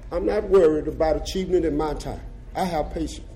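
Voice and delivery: ordinary talk, nothing marked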